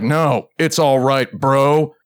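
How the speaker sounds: slow, laid-back gym-bro voice; mock tough bro. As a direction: play it like a cool muscle guy. Mock cool refusal to strongman.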